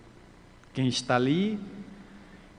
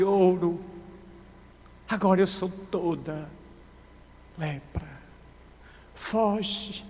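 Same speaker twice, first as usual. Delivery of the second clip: raised, urgent pleading that falters into pauses; anguished dying woman; 3 semitones higher, 4 dB louder than usual. A narrator's reading. Leprous woman warning Jesus to flee, in anguish. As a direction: cry out the warning, higher and insistent.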